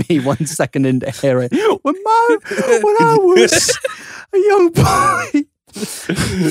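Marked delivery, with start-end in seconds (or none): 1.8-6.5 high-pitched, drawn-out hammed-up wail; very high, very slow mock sob; mock crying. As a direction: blub like a wounded little boy. Mock crying about childhood memory.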